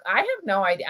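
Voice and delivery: ordinary talk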